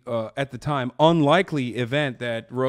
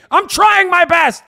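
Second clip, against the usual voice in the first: much higher, loud, slow yell; shout; 11 dB louder, slower than usual. Real, not acted. Streamer ending his rant with a shout. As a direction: yell it at full volume.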